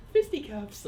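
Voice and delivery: high, cutesy fisticuffs. Shown as a demonstration. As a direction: say it like something adorable. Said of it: "that sounds like a cute".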